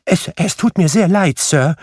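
high, stammering apology; nervous apology. A narrator's reading. Bob apologising to Scrooge for coming late, nervous high stammer. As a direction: plead high and flustered.

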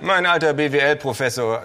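affable. Acted. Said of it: "sag ich leutselig".

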